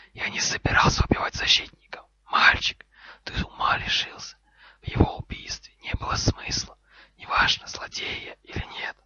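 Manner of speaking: loud, furious whisper; raging snake hiss. Acted. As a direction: hiss the rebuke with venom and no voice. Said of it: "В шипении змеи было больше ярости".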